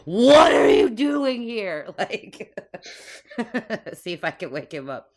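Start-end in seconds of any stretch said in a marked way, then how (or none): 0.0-1.9 slow, booming shout, higher and louder; a spell-amplified yell into his face; magically loud shout. Acted. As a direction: bellow it to wake a sleeper. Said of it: "make my voice, like, super fucking loud and go".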